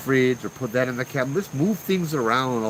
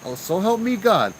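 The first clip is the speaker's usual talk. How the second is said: high, heated threat; retold yelling; 9 semitones higher, 4 dB louder than usual. Uncle threatens the ghost in the shower, mock angry yell. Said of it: "I went, yell at him".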